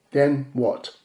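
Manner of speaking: ordinary talk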